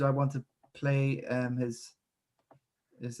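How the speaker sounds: normal talking voice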